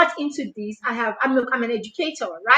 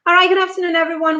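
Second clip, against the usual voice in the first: ordinary delivery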